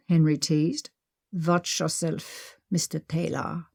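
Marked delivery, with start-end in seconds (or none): 1.3-3.7 a put-on German threat, drawn out slowly and softer; playful fake German accent teasing her partner. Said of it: "Maggie said in her fake German accent".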